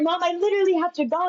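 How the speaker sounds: rushed, high-pitched protest; imitated exasperation. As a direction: plead hurriedly to get off the phone. Daughter rushing off the phone.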